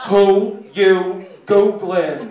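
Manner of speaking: very slow, loud desk lady; front desk lady. Acted. Desk lady to the security guard, loud and drawn out. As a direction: stretch each word loudly across the room. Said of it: "she looks over to the security guard".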